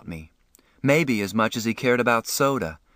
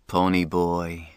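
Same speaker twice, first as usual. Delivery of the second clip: husky voice; 5 semitones lower, slower than usual. A narrator's reading. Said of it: "said in a husky voice".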